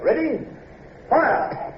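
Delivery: high, commanding fire order; shouted order. Acted. Officer calling the gun crew to fire.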